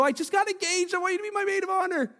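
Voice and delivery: high, fast, bubbling with news; excited. Shown as a demonstration.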